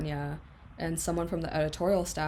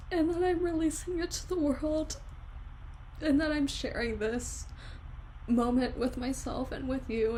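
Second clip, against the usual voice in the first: higher, teary voice of relief; real crying with relief, pitched up; tearful relief; 7 semitones higher than usual. Real, not acted. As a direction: speak through happy tears. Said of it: "first time that I've cried on camera".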